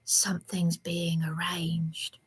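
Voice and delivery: slow, hushed conspiratorial whisper; a retold whisper of a hidden plan; secretive whisper. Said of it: "heard him on the quiet saying".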